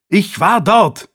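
high, loud, drawn-out angry protest; furious cry. A narrator's reading. Nephew jumps up to answer his uncle's accusation, furious. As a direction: shout it back in fury. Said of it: "rief er wütend".

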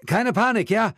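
loud, high call into the microphone; urgent shouted appeal for calm; singer addressing crowd. A narrator's reading. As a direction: raise your voice to settle a crowd. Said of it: "Der Leadsänger sagte ins Mikrofon".

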